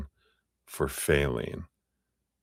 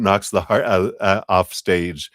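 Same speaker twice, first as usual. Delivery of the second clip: normal talking voice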